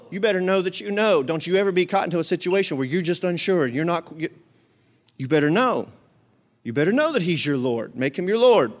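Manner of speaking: ordinary talk, nothing marked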